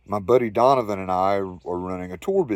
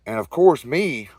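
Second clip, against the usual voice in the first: ordinary talk, nothing marked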